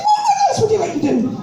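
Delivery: high, plummy posh lady voice; posh society lady greeting the jobseeker. Retold or imitated. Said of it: "I've got to get the right voice in".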